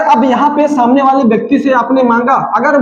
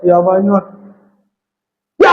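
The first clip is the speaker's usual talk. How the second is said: gruff, unfriendly; 4 semitones lower than usual. Shown as a demonstration. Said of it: "कोई ऐसे बोलेगा".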